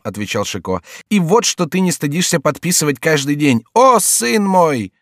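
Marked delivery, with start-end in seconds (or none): 1.1-4.9 high, drawn-out theatrical lament; mock horror. A narrator's reading. Jester shaming the king for his signature, mock-horrified lament. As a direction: wail in comic reproach.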